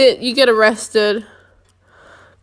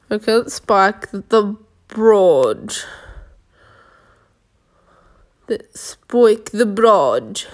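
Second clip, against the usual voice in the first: hammed-up, badly faked British accent; exaggerated mock British accent; horrible accent. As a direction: put on a terrible British accent, drawing out the words. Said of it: "that was a horrible accent".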